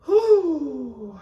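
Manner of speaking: shrill exhale of relief; loud high whew breath. Real, not acted. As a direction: let out a high whoop of relief. After emotional moment.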